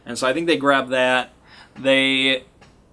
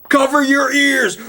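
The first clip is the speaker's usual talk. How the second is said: 1 shouted warning; 11 semitones higher, 5 dB louder than usual. Retold or imitated. Hunter firing elephant gun, shouting.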